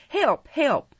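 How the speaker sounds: high, urgent call for help; cry for help. A narrator's reading. Oliver struggling in the man's grasp, crying for help. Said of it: "cried Oliver, struggling in the man's".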